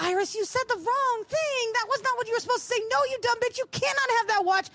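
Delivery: high, exasperated rant at her partner; mock rant. To Iris for saying the wrong thing, a high mock rant. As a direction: scold her partner in fake outrage. Ranting at Iris's reply.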